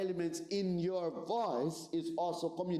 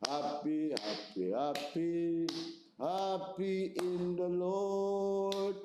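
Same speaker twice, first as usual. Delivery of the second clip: drawn-out, hammed-up churchgoer singing happy with a sour face; mock joyless praise; much slower than usual. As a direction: parody someone praising with no joy. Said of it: "I've seen people at church".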